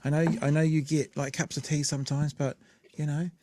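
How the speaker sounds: tender aside. Real, not acted. Thanking partner who brought dinner.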